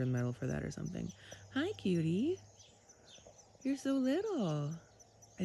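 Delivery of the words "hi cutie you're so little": cooing. Real, not acted. Cooing at caught skunk.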